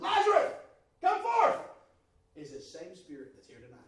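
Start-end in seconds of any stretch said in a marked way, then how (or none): 0.0-1.6 commanding call. Acted. Commanding Lazarus to rise.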